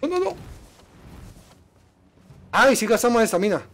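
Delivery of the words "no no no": very high frightened cry; real fright; panicked no. Real, not acted. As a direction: shriek it as they catch you. Caught by enemy.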